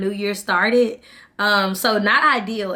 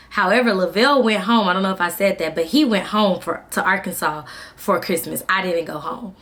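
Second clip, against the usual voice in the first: ordinary delivery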